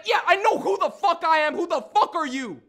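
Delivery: loud, high-pitched hostile retort; angry shout. Acted. Nigel demanding who this kid is, angry shout.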